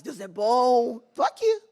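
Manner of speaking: bursts up high and loud; triumphant, beaming; exultant cry of faith; joyful praise. Retold or imitated. Retold exuberant praise of God.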